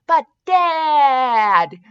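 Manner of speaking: put-on long pleading kid's whine; whining. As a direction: whine it in protest to a parent. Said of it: "Leo whined again".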